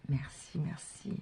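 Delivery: soft breathy merci whispered thrice; whispered thanks. Real, not acted. Reader thanking the angels in a whisper. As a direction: murmur the thanks under the breath.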